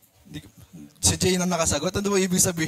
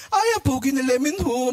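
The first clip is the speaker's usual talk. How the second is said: higher, giddy swoon over a crush; gushing fangirl; 5 semitones higher than usual. Retold or imitated. Women in line gush over a cute guy, giddy and high. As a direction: gush like a smitten girl in a queue. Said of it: "mga kababaihan, pinag-uusapan".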